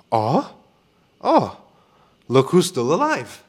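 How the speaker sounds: high, slow mock surprise; sarcastic greeting. Greeting the client who vanished, slow and sarcastic. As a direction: drag it out, needling him.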